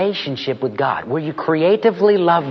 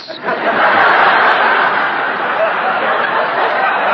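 high burst of laughter. Real, not acted.

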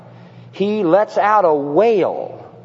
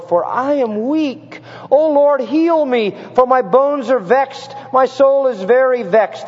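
high, impassioned plea; crying out; 7 semitones higher, slower than usual. Retold or imitated. Preacher voicing the psalmist's plea to God, crying out. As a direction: raise the voice into a pleading cry, stretching the key words. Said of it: "The psalmist cries out in Psalm six".

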